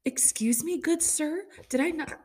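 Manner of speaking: high, mock-offended excuse me, good sir; offended wife rebuking husband, haughty; indignant excuse me. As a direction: play it scandalized and high-pitched. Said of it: "I was like".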